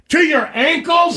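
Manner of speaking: high, slow shouted disbelief; buddy shouting in shock at a kid in the bathroom; incredulous. Retold or imitated. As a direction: yell it like you can't believe it.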